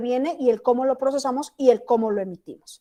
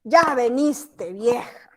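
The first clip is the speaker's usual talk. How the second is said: slow, grudging ¿Ya veniste, vieja? with ya leaned on; husband annoyed his wife has come home; displeased; much slower than usual. Shown as a demonstration. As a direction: drag ¿Ya veniste, vieja? out, unhappy she is back. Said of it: "No le gustó que llegó la vieja".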